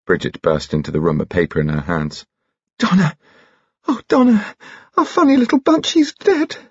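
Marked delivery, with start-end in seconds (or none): 2.8-6.6 put-on high-pitched distraught girl; hysterical. A narrator's reading. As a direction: cry out the news hysterically, voice way up. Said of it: "please don't be hysterical".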